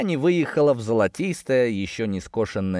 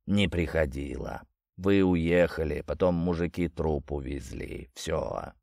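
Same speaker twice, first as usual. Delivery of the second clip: low gurgling water spirit; 6 semitones lower, 5 dB quieter than usual. Acted.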